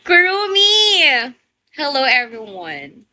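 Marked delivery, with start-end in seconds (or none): 0.0-1.3 drawn-out happy call to a viewer; calling out a viewer's name on a live stream; excited call-out. Real, not acted. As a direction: sing out the name, slow and bright.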